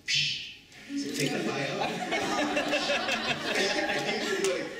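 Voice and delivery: high, loud, slow words through laughter; real helpless laughing speech; cracking up. Real, not acted. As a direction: drag out the punchline while bursting with laughter. Cracking up at his punchline.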